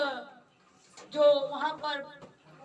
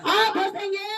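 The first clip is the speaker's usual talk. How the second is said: loud and high, raise the hall; rousing shout; 5 semitones higher, 7 dB louder than usual. Real, not acted. Preacher rallying his congregation to laugh, rousing shout.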